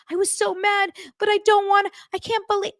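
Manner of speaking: high, whiny imitation of weepy blubbering; hammed-up, broken-off tearful protest; mock crying. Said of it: "he was pulling a Robin".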